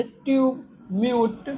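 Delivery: ट्यूब, म्यूट drawn out with a long yoo. Shown as a demonstration. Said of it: "यू एवं उ दोनों उच्चारण". (ट्यूब, म्यूट में लंबा यू)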